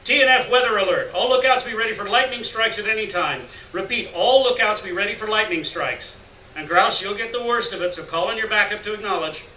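loud dispatcher alert over the receiver; blaring radio. Acted. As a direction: bark the weather warning like a radio call. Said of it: "blared the receiver".

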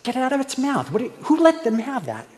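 high, alarmed mock scold; alarmed adult seeing a smoking baby. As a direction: react like someone seeing a baby smoke.

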